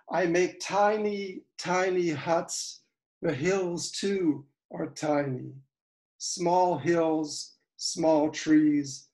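spare, measured verse with pauses between images; poem recitation. Acted. Poet reading about making miniatures, deliberate recitation. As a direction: set down each small image separately.

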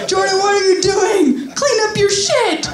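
high mock mom telling him to clean up; mom scolding. Mom orders son to clean up, high scolding voice. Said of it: "She's like".

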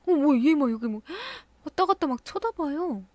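jumpy, gasping at a creepy doll; real fright breaking into a gasp; startled. Real, not acted. Doll in horror game startles her.

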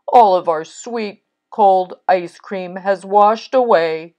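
mildly hammed-up complaint of loss; dismayed Toad. Toad laments the lost ice cream.

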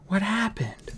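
soft, high, stricken question; sighed dismay. Acted. Fairy seeing the frozen bodyguard, softly dismayed. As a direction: ask it hushed, pitch lifted. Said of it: "sighed Holly".

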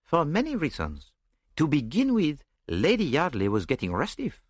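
put-on lightly accented, lilting detective; a hint of a French-speaking sleuth; genial foreign detective, explaining. A narrator's reading. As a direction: begin listing reasons patiently.

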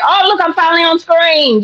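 a hint of a proud fan seeing herself; delighted. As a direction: point at the screen with glee. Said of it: "I'm like".

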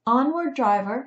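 high, loud command to a driver; calling out. A narrator's reading. Rescuer calling to the carriage driver, calling out. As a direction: sing out the order.

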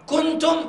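كنتم with the nun hidden in a nasal hum. Shown as a demonstration. Teacher showing the correct version. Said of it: "أخفيت".

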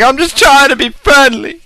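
loud, high acted plea; mock defensive protest. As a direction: protest at the top of your voice, hammed up. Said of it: "Be like".

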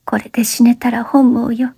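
breathless whisper. A narrator's reading. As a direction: whisper it in passion. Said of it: "荒々しい動作の中で言った".

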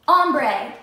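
loud, sudden burst of relief; triumphant shout. Real, not acted. Vlogger finally remembers the word, loud triumphant shout.

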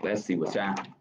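plain reading voice

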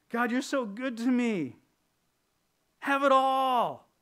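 high, loud, breathless gushing; gushing giver. Acted. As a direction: ham up a starry-eyed worshipper. Mock over-eager giver again.

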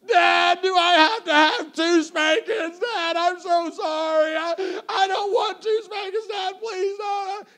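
loud, high, drawn-out hysterical pleading; mock child wailing. Daughter begging dad over two spankings, mock wailing. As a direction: wail like a kid begging off punishment.

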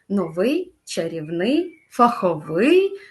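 "новий, чарівний, фаховий" each stressed on the last syllable; stress on final syllable. Shown as a demonstration. Teacher lists words for students, "новий, чарівний, фаховий" with final stress. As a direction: lift and stretch the endings of "новий, чарівний, фаховий". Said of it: "останній склад".

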